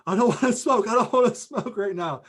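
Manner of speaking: high, loud, frightened refusal; guy freaking out as Tony yells at him; panicked protest. Retold or imitated. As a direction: plead in alarm, voice shooting up.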